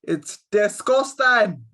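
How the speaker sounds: another bad Scottish accent try; slow, hammy attempt at Scottish accent. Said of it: "I cannot do a Scottish accent to save my life".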